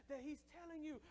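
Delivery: ordinary delivery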